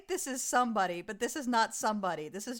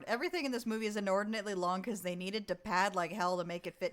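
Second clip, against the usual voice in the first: normal talking voice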